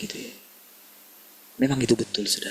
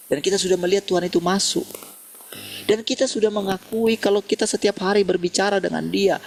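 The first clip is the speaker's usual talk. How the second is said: raised, ringing preacher's voice, pitched higher; fervent preaching; 7 semitones higher than usual. Acted. Preacher urging congregation in a full-force sermon voice. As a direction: lift the congregation with rising conviction.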